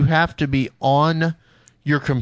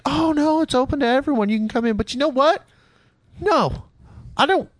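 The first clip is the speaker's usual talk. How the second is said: imitating buddy; 11 semitones higher than usual. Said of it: "my buddy's like".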